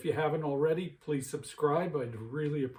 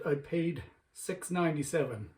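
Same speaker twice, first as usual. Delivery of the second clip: ordinary talk, nothing marked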